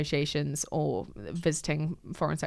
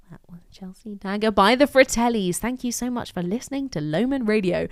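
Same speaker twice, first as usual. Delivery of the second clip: radio presenter outro, starting hushed then louder; quiet, then coming in; 4 semitones higher, 4 dB louder than usual. Retold or imitated. Radio host with microphone trouble, quiet building to full voice. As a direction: fade up from very quiet into a bright back-announce. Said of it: "quiet at the beginning and then came in".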